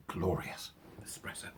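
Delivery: hushed whisper to himself. Real, not acted. Savouring his espresso alone on camera, whispered. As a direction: breathe it out as a whisper over the cup.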